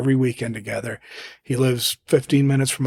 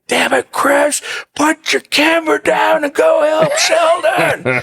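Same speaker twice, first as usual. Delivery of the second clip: much higher and louder, laughing shout; exasperated yell; 6 dB louder, slower than usual. Retold or imitated. Friend yelling at the photographer to help, loud high laughing shout. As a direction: bark it at the guy with the camera, half laughing. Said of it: "And he's like".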